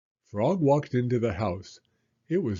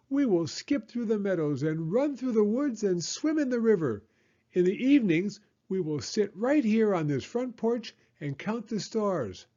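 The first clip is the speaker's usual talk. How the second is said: high frog voice painting a happy picture; dreamy cheerful frog; 10 semitones higher than usual. Acted. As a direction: dream aloud of spring days. Frog's voice.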